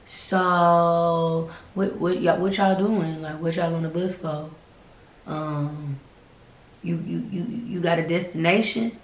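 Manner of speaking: low, slow nosy stranger voice; halting, softly drawled questions trailing into ums. Retold or imitated. Man on the bus prying at the teachers, halting and low. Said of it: "he just keeps staring like".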